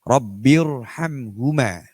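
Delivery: Rabbirhamhuma slow, the R full and heavy; heavy R demo. Shown as a demonstration. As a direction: say Rabbirhamhuma with a thick R. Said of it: "dia dibaca tebal".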